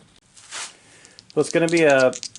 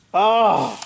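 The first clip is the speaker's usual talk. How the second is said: pained cry; 5 semitones higher, 4 dB louder than usual. Real, not acted. Another bad roll.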